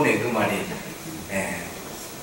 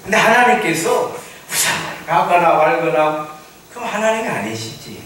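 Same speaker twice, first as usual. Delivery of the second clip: ordinary talk, nothing marked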